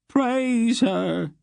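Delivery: high, chanted ritual reply; ritual response. A narrator's reading. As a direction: intone it like worshippers. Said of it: "responded ritualistically".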